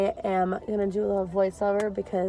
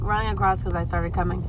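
usual speaking voice

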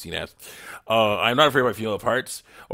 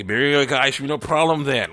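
emphatic retort; 5 semitones higher, 9 dB louder than usual. Retold or imitated. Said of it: "And I say".

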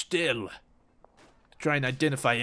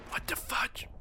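softer whisper; 8 dB quieter than usual. Real, not acted. Repeat whisper.

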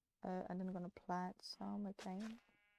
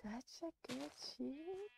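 creepy cooing; 4 semitones higher, 5 dB quieter than usual. Acted. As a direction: coo at it like a creep. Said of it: "you sound so creepy".

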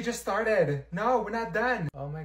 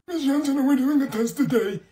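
higher, louder, nagging repeat; whiny student voice; 9 semitones higher, 6 dB louder than usual. Acted. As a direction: pester the teacher like a whiny kid. Same question repeated, higher and louder.

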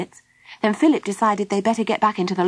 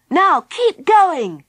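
high, loud, drawn-out urging; forceful slow shout to keep at it; yelling; 8 semitones higher, 5 dB louder, much slower than usual. A narrator's reading. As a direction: yell encouragement with each word stretched. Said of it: "he yelled to Baby Bee".

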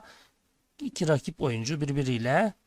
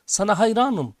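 raised, swelling call of praise; narrated shout; 5 semitones higher, 6 dB louder than usual. A narrator's reading. Father turns to the mountain, narrated shout. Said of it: "diye bağırıyor".

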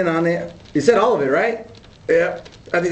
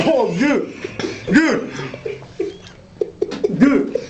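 gasping from chili heat; burning mouth; 5 semitones higher, much slower than usual. Real, not acted. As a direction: mouth on fire, gasping dude. Reacting to ghost chili burn.

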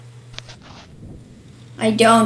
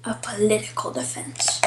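soft, secretive whisper; 4 semitones lower, 10 dB quieter, faster than usual. Real, not acted. Whispering the card name to the opponent.

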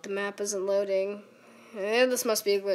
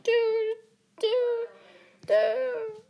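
squeaky high "do, do, do"; voice cracking high; 12 semitones higher, much slower than usual. Retold or imitated. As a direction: sing it with a cracking falsetto. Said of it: "my voice just literally killed me".

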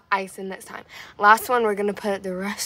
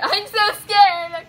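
high, loud and slow scared whine; scared wail; 8 semitones higher, 5 dB louder, much slower than usual. Real, not acted. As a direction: drag out the fear, louder.

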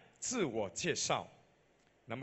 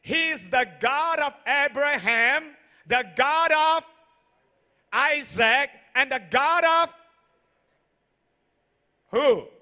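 loud, high preaching cry building to the names; preaching at full force; 7 semitones higher, 9 dB louder than usual. Acted. Pastor booming to the congregation, full-force preaching. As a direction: proclaim it to the whole hall.

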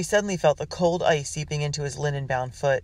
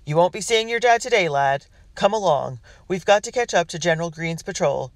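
loud, higher commanding call; 4 semitones higher, 5 dB louder than usual. Acted. Captain wakes Matt to catch the patrol, calling out. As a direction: get the boy up and moving. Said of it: "a deep voice called".

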